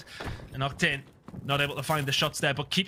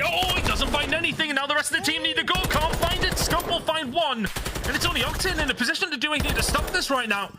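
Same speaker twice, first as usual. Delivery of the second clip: much higher, urgent esports commentary; high-energy play-by-play; 11 semitones higher than usual. Real, not acted. Caster calling the fight for the point, high-pitched excited play-by-play. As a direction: call every move at peak excitement. Live call at full pitch.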